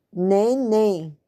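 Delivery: neném with a closed, nasal ê, as in "nay"; closed nasal vowel. Shown as a demonstration. Teacher sounding out neném for pupils, closed vowel. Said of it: "se você botar assim".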